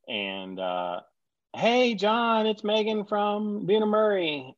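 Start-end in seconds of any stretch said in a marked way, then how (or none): 1.5-4.5 cheery producer on the phone. Retold or imitated. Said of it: "caller quoted".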